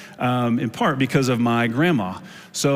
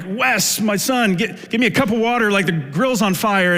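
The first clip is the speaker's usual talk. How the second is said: higher, harried call for help; calling out to son; 7 semitones higher than usual. Retold or imitated. Dad at the grill calls his son for water, harried. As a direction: yell across the yard mid-chaos.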